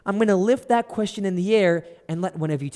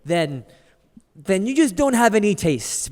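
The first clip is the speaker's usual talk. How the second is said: normal talking voice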